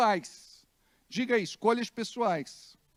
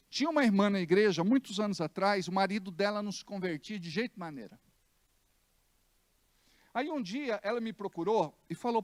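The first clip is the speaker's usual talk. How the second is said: ordinary delivery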